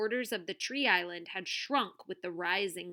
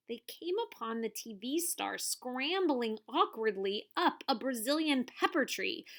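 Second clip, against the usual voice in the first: usual speaking voice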